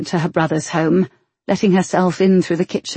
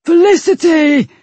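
loud anguished roar; roaring a name; 11 semitones higher, 6 dB louder than usual. Acted. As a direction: roar her name into the dark. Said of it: "he roared her name again".

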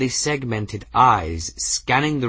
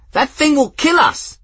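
very high, loud terrified yell; panicked scream; 9 dB louder than usual. A narrator's reading. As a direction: shriek in terror.

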